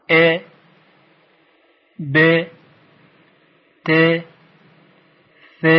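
each letter "ا، ب، ت" with a short "e": e, be, te; single short "e" syllables, a pause after each; short kasra vowel. Shown as a demonstration. Teacher has learners read the letters along, short kasra sounds.

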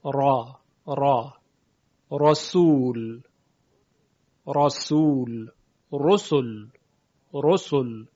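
"را" and "رسول" with a thick, full, heavy R; heavy tafkhim R. Shown as a demonstration. Teacher shows listeners the heavy R of tafkhim, thick and deliberate. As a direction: draw out "رسول" with a heavy emphatic R.

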